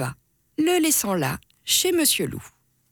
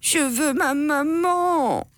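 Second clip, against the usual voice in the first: put-on high, slow whining kid; crying kid; 6 semitones higher, slower than usual. A narrator's reading. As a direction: wail for mommy. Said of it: "se met à pleurer".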